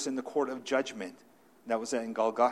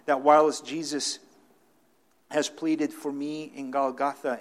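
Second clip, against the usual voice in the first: louder, higher preaching build; 3 semitones higher, 7 dB louder than usual. Acted. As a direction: drive it home to the church.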